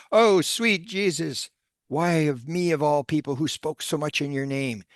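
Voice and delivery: high, drawn-out wail of dread, acted out; anguished pleading. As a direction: play a preacher crying out to heaven in despair. Terrified preacher wails to Jesus.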